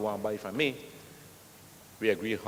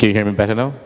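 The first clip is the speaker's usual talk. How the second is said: louder into the mic; 10 dB louder, faster than usual. Real, not acted. Speaker told he can't be heard, answers much louder. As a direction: raise the voice so the back of the room hears. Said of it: "Could you speak".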